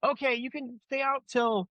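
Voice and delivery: put-on high, pleading kids' voices then mom giving in. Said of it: "we'd go back down and be like".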